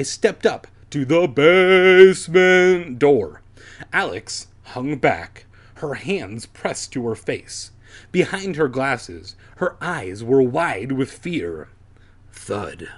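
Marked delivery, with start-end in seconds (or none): none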